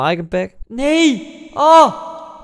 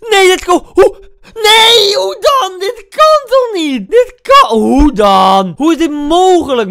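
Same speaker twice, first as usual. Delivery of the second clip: high, loud, whining despair; anguished wail; 12 semitones higher, 8 dB louder, much slower than usual. Real, not acted. Gamer wailing loudly after conceding a goal.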